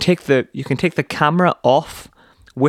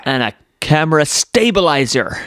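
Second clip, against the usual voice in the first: hammed-up American keynote voice, higher pitched; Steve Jobs; 4 semitones higher than usual. Host unveils the stabilizer, terrible American launch accent. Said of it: "This is the worst American accent ever".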